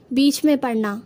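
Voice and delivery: ordinary talk, nothing marked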